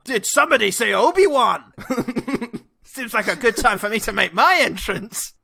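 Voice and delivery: loud, high, theatrical pop-in voice; hammy Obi-Wan entrance. Acted. Obi-Wan announcing his entrance, hammed-up and loud. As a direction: burst in like a smug surprise guest.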